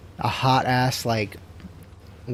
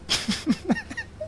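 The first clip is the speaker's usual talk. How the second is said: sudden squeal of laughter; high squeaky laugh; 7 dB quieter than usual. Real, not acted. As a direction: giggle high up. Reacting to chat.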